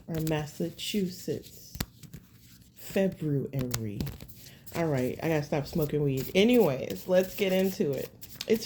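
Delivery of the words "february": February drawn out low and slow, Feb-ru-ary; careful February. Shown as a demonstration. Tarot reader corrects herself, slow careful February. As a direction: say February deliberately, syllable by syllable. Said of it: "That's like me saying Massachusetts".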